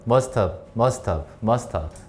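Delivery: "must have" run together as one word; fuse "must have", with "have" shortened onto "must"; linked pronunciation. Shown as a demonstration. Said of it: "붙여버려". (must have를 한 단어처럼 붙여 읽기)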